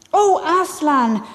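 awed exclamation, lightly acted; amazed cry. A narrator's reading. Both children see Aslan alive, amazed cry. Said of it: "cried both the children".

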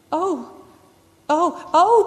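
high, separated cries of dismay; acted distress, rising in pitch. A narrator's reading. Two girls find the stone table empty, dismayed cries. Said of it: "cried the two girls".

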